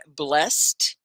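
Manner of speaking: BLESSED said as one syllable, "blest". Shown as a demonstration. Teacher asks a student about the name, BLESSED in one syllable. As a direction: say BLESSED short, ending in a t. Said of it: "One syllable or Blessed?".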